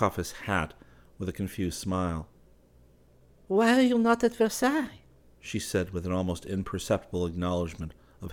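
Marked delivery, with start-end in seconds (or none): 3.5-5.0 high, light woman's voice, cool and teasing; young Parisian woman. Acted. Valentine asks Clifford why he is not at Versailles, in a high, teasing woman's voice. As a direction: play her as poised and a little mocking.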